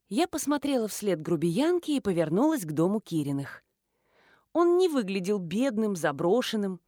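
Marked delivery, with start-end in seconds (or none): none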